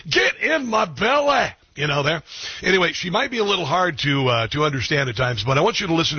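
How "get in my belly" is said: high, slow mock Scottish growl; Scottish Fat Bastard line. Host quoting the movie line in mock Scottish. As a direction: ham up a Scottish accent. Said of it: "everybody could say".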